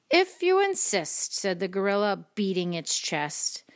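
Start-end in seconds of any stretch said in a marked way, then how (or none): 0.1-1.4 high, lilting storybook reply; obliging reply. A narrator's reading. Creature accepting the ladybug's challenge, high and lilting. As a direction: accept the dare in a raised voice.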